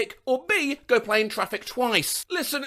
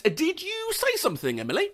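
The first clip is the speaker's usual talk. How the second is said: high, soft, tentative question; hesitant coworker; 11 semitones higher, 4 dB quieter than usual. Retold or imitated. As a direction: imitate a coworker asking cautiously, high then dropping. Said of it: "one finally went".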